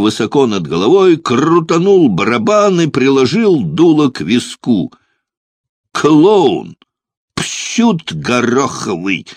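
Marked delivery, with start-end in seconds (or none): 5.9-9.3 contemptuous outburst, easing off in volume; hissed insult. A narrator's reading. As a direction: spit out the insult, then slow down. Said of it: "прошипела храбрая немка".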